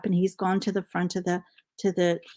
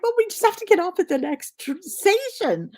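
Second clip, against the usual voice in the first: much higher, fast, laughing through it; 11 semitones higher than usual. Retold or imitated. Telling dad and brother to get off next stop, laughing. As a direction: answer while barely holding the laugh in. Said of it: "And I'm like".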